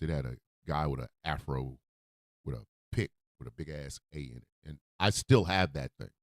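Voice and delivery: ordinary delivery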